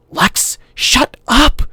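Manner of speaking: put-on high urgent boy hissing shut up. A narrator's reading. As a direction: snap at his sister, high and slow. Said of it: "Tim said".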